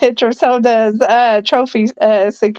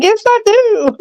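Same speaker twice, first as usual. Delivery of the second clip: much higher, playful retold comeback; mock insistent retort; 12 semitones higher than usual. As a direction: push it way up in pitch. Retells own retort in argument.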